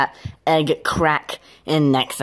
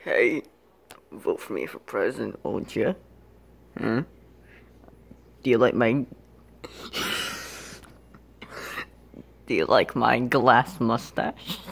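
low, soft mock-suave voice; mock-suave pitch; 6 semitones lower, 6 dB quieter than usual. Asking for votes for president, soft mock-suave. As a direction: drop low and soft to woo them. Mock wooing of voters and ladies.

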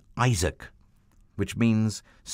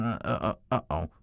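losing count; 4 semitones lower, 3 dB quieter than usual. Acted. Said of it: "he kept losing count".

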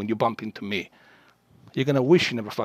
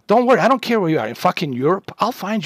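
angry shout; 6 semitones higher than usual. Real, not acted. Streamer threatening to find the troll, angry shout. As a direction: hurl it at him, real fury.

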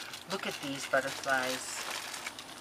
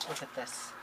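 ordinary talk, nothing marked

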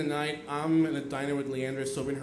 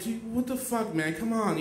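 mild high-pitched retold protest; exasperated; 6 semitones higher than usual. Complaining to Leandra making out on top of him, exasperated. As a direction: plead in annoyance, voice lifted.

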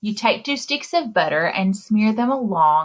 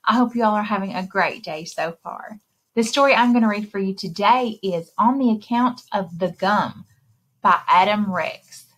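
ordinary delivery

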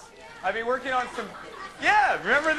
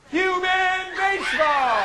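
announcer shout; 8 semitones higher, 5 dB louder, slower than usual. Acted. Announcing a game to crowd.

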